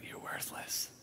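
sneering whisper; Satan whispering an accusation. Retold or imitated. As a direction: breathe it like a cold accusation. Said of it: "Satan will just take you and go".

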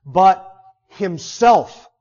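"himself" drawn out very slowly to stand out; emphasis on HIMSELF. Shown as a demonstration. Preacher shows Paul's fronted word, "himself" slow and emphatic. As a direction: set "himself" apart, slow and weighty. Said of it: "so that it's emphasized".

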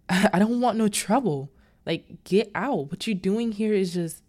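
loud, insistent warning; firm dismissal. Retold or imitated. Pastor warning Missus Reef to leave, loud and firm. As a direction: push her out the door.

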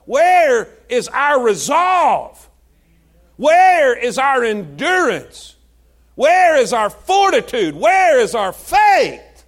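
slow, loud, hammering preacher's cry; slow shouted sermon questions; shouted challenge. Acted. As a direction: pound each question at the pews. Preacher's repeated challenge to congregation.

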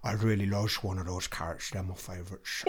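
low, soft dog voice with slurred s sounds; Peaches. Acted. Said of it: "doing Peach's voice".

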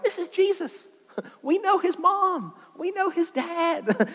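very high, incredulous squeal; hammed-up high-pitched disbelief; scoffing townsfolk. Crowd acted, scoffing at familiar Jesus.

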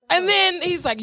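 ordinary delivery

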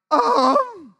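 high, loud cracking "um". Retold or imitated. Teller frozen before the school, voice cracking. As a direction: imitate a nervous squeak. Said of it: "my voice is cracking".

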